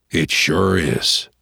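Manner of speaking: slow, low repeated agreement; Pa, pondering. Acted. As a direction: drag it out thoughtfully.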